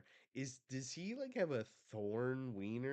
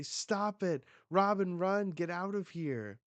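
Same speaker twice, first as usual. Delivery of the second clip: higher-pitched acted cry; urgent warning; 7 semitones higher, 3 dB louder than usual. Friends telling Robin to flee, urgent warning. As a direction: call out a frantic warning.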